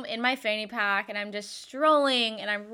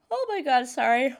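yawning through it; 3 semitones higher, 3 dB louder than usual. Real, not acted. Yawning mid sentence.

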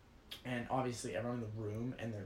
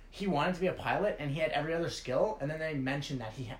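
usual speaking voice

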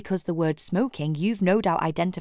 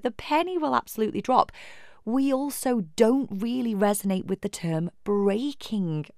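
normal talking voice

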